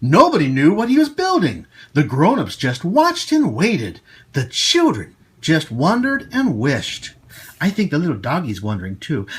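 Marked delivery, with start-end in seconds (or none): 0.0-7.1 put-on bright storytelling voice for children; storybook reading. Host reading the candy cane story to kids. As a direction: read it out like a picture book at story time. Reading the picture book aloud.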